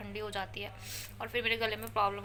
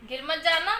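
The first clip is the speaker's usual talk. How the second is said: calling warning; 6 semitones higher, 8 dB louder than usual. Real, not acted. Warning child from afar.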